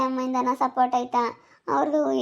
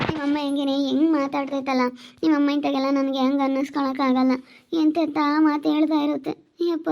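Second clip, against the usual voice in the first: normal talking voice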